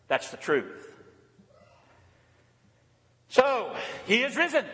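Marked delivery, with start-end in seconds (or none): 3.3-4.7 "he is risen" called out higher, "risen" held and falling; Easter acclamation. Acted. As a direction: call out "he is risen" to the church.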